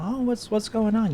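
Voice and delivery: high, eager question; retold curiosity. Coworker asking what is happening, high curious voice. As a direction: ask it high, like an inquisitive pal. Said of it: "you know".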